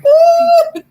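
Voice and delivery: very loud high wail; mock crying wail. Smacked child crying out, mock wail. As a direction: burst into an imitated bawl. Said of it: "just crying".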